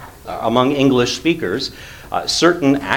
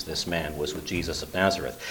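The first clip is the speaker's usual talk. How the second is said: much softer, a low confiding aside; hushed telling; 5 semitones lower, 11 dB quieter than usual. Acted. Servant girl pointing Peter out to bystanders, hushed. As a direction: lean in and tip off the bystanders quietly.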